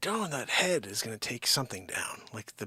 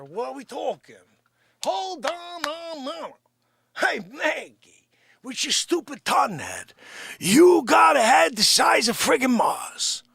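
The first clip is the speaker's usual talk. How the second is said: higher-pitched scolding, building to a shout; blustering alter-ego character; 13 semitones higher, 11 dB louder than usual. Acted. Character berating Maggie about her head, blustering. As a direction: badger them in a raised character voice.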